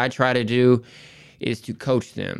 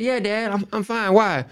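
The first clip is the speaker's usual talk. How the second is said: higher, lightly voiced young daughter; imitating his daughter; 10 semitones higher than usual. Dad quoting his daughter's reply in a higher voice. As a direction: raise the voice to play his little girl.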